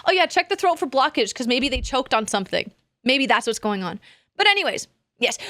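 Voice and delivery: normal talking voice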